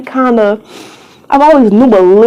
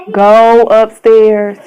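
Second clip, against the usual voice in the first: stern repeated order; 5 dB louder, much slower than usual. Real, not acted. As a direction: draw out each word so they obey. Repeats the order more forcefully.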